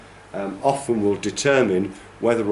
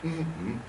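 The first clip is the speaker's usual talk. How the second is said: soft hum of a two-beat word, first beat stronger; hummed word; 8 semitones higher, 9 dB quieter than usual. Shown as a demonstration. Teacher humming apple to show word shape, soft stressed-unstressed hum. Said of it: "if you hum apple".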